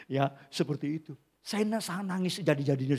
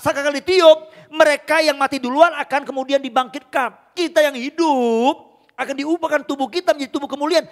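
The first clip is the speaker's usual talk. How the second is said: loud, higher proclamation of the resurrection; fiery preaching; 11 semitones higher, 9 dB louder than usual. Acted. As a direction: preach it to the whole hall. Preaching resurrection at full force. (berkhotbah dengan berapi-api)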